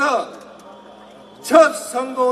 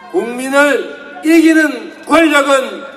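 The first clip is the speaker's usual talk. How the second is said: loud rally proclamation; fiery revolutionary speech; 3 semitones higher, 6 dB louder than usual. Real, not acted. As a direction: fire up the crowd, loudly. Rally crowd-rousing climax.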